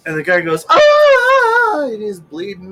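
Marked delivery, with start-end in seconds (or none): 0.7-1.8 high, loud yelp of a man struck; a sudden pained yelp, much higher; cry of pain. Retold or imitated. Joke's driver hit by the cop, loud pained yelp. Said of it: "crying, screaming".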